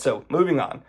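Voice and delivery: usual speaking voice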